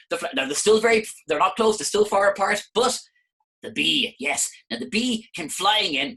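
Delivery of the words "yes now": plain reading voice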